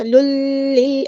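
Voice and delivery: لل with the l held too long; wrongly held lam. Shown as a demonstration. Teacher shows the error, overlong l in لل. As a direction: linger on the doubled l of لل. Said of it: "time نہیں دینا ہوتا".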